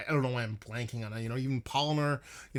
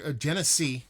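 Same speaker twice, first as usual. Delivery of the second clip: ordinary talk, nothing marked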